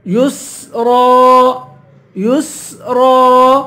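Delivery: یسرا very slowly with a sustained س; whistled seen. Shown as a demonstration. Teacher showing the letter seen, یسرا with whistling س. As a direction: hiss the س in یسرا, draw out the ending. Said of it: "سین کی سیٹی نکالیں گے".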